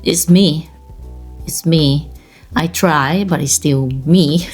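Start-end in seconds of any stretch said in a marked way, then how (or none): none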